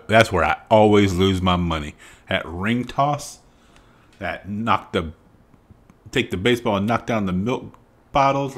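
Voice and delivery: usual speaking voice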